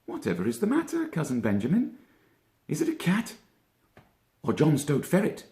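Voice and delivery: high, quick puzzled rabbit; Peter Rabbit voice. A narrator's reading. Peter asking his cousin, puzzled high rabbit voice. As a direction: lift the voice into a curious young rabbit.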